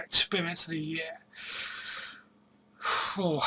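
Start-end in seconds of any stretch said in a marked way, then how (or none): 1.4-2.1 long breathy exhale; thinking sigh. Real, not acted. Pondering the strangest boot fair experience, a long exhale. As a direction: blow out air while thinking.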